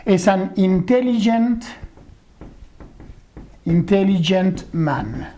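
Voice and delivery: slow careful English, intelligent man; teacher slowly models an intelligent man. Shown as a demonstration. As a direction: drag out intelligent man for the student. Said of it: "Yo diré".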